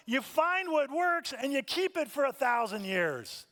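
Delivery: high, loud, fast lecturing rant; exasperated scolding. Retold or imitated. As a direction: scold them for always changing.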